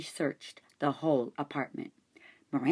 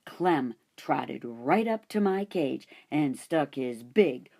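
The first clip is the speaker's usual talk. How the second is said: usual speaking voice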